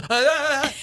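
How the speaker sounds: sudden high startled yelp; a quick high scream of fright. Retold or imitated. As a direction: jump and squeal at the surprise. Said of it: "like that".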